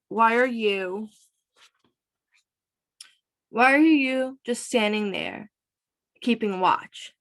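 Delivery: mildly annoyed, slow questioning; understated acted annoyance. A narrator's reading. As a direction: press the question slowly with a touch of irritation. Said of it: "she's annoyed".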